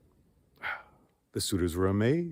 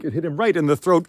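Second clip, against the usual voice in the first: plain reading voice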